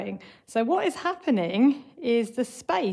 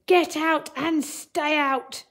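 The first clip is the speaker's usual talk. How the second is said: slow, higher, louder mock-angry order; shouted; 6 semitones higher, 4 dB louder, slower than usual. A narrator's reading. Teacher reading story, angry Mister Archimedes shouting at Wombat. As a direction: bark it out slowly like a cross storybook man. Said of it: "he shouted".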